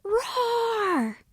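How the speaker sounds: high, drawn-out playful roar; big storybook scare roar; yelled roar. A narrator's reading. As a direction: jump out to scare someone. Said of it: "and jumps out yelling".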